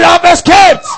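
loud, high rallying shout; shouted declaration. Shown as a demonstration. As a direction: shout it to lead the congregation. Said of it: "Come on, shout".

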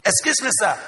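high, hurried secretary voice; interrupting secretary. Acted. Secretary knocking to interrupt the pastor, high and hurried. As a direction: pipe up quickly, like an interrupting secretary. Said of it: "if the secretary".

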